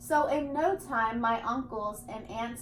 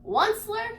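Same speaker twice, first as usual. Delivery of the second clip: higher, sharp call of the name; 8 semitones higher than usual. A narrator's reading. The Lorax cries out to the Onceler, high and urgent. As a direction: cry the name out to grab his attention. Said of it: "he cried".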